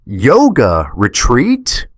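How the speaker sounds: slow, high, loud yell of disbelief; furious wife screaming through the phone; screamed. A narrator's reading. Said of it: "she screamed through the phone".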